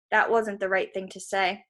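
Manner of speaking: ordinary delivery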